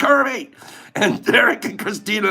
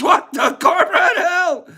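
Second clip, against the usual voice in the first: laughing shout; 9 semitones higher than usual. Real, not acted. Laughing outburst at a chat name.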